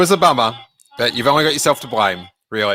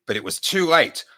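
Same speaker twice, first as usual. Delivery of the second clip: ordinary delivery, nothing marked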